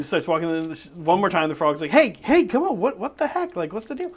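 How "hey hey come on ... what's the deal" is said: high-pitched, exasperated frog; acted exasperated protest. Frog objecting to being pocketed by the engineer, high exasperation.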